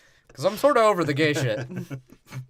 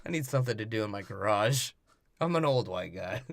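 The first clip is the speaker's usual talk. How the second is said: lower, gruff-sounding dad voice; 8 semitones lower, 6 dB quieter than usual. Retold or imitated. Dad shooing his daughter out, lower voice. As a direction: play the grumpy old dad in the garage. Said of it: "He's like".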